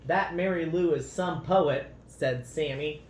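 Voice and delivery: lively, expressive storybook read-aloud; reading to children. A narrator's reading. Parent reading a picture book to children, lively storybook voice.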